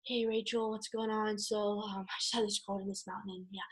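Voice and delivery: the dull phone call you shouldn't make; bored and flat. Shown as a demonstration. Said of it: "you guys are not gonna talk like that".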